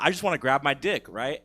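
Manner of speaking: ordinary talk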